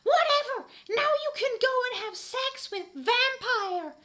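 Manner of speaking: very high, loud sneering yell; hammed-up shout. Ebony yelling back at Draco, very high shriek. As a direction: fling it back in fury. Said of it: "I shouted".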